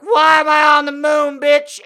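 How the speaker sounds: put-on loud, high drunken yell; drunken shout.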